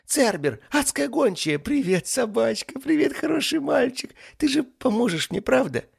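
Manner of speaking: high, loud squeal of delight; overjoyed greeting. Acted. Demon greets Cerberus hoping for help, overjoyed. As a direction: coo excitedly at a beloved dog. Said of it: "радостно воскликнул он".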